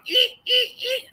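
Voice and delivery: tiny high squeaks, eek eek eek; mock squeaky footsteps; imitated squeaking. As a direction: squeak like shoes on a floor, high and thin. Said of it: "his arse squeaks".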